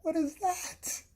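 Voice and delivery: very high, slow incredulous squeal; dying with disbelief. Retold or imitated. Two friends seeing the robber's fake weapon, squealed disbelief. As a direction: squeal it in mock hysterical disbelief. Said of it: "instantly look at each other and start dying".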